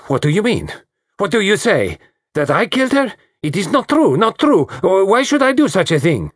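high, shrill outburst of denial; spat out in fury. Acted. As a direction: spit the words at him, outraged. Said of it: "She spat out".

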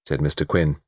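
ordinary delivery, nothing marked

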